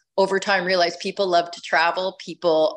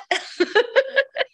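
high laugh. Real, not acted. Laughing at her own story.

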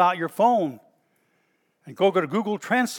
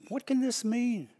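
higher, softer puzzled wonder; perplexed and amazed; 5 semitones higher, 5 dB quieter than usual. Acted. Onlookers perplexed at the believers, amazed and puzzled. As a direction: ask it in high, quiet bewilderment. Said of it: "They stood there perplexed and amazed".

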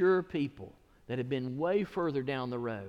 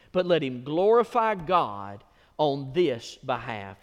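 louder, slowing at the end; preaching scripture; 8 dB louder than usual. Real, not acted. Pastor reading scripture aloud to church, loud and slowing. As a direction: proclaim the verse to the congregation.

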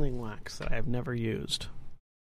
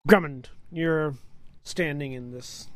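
ordinary talk, nothing marked

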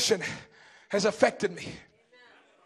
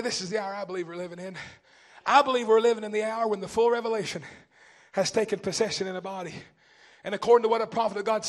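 soft preaching appeal; 2 semitones lower, 3 dB quieter than usual. Acted. Preacher pleading gently with the congregation, softly.

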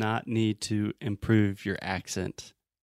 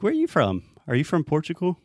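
quick higher, louder mock question; curious questioner; 6 semitones higher, 4 dB louder, faster than usual. Teacher imitating people questioning him in Brazil, curious. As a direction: play an inquisitive local, pitched up. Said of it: "When I'm in Brazil, I always get the question".

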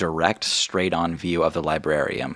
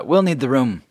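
higher, louder dismissal; Margot dismissing; 8 semitones higher, 6 dB louder than usual. Acted. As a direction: clear the room politely. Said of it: "gestures ... and says".

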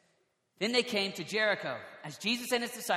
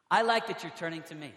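normal talking voice

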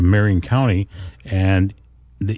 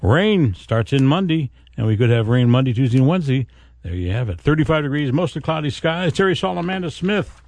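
upbeat forecast read on air; bright announcer weather report; radio weather read; 7 semitones higher than usual. Acted.

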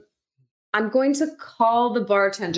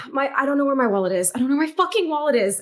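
acted fluster, pitch climbing on the curses; getting frazzled; 5 semitones higher than usual. Player's character searching her bag for a wallet, getting frazzled. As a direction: sigh and fret, increasingly frazzled. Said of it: "start getting frazzled or whatever".

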